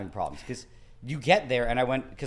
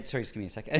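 soft, trailing self-interruption; muttered apology; 3 semitones lower, 6 dB quieter, much faster than usual. Retold or imitated. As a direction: mutter it under your breath. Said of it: "You kinda, like, start muttering".

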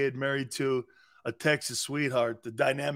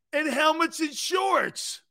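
incredulous; 11 semitones higher than usual. Real, not acted. Mocking a player, incredulous.

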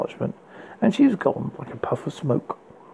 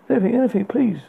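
high, fawning begging; groveling; 8 semitones higher than usual. A narrator's reading. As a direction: grovel and flatter desperately. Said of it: "he groveled".

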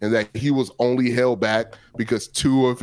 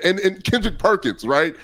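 normal talking voice

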